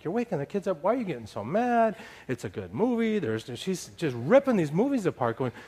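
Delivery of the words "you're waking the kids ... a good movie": higher-pitched mock pleading; defensive protest. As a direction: act out a bewildered self-defence. Acted quarrel, defending himself to wife.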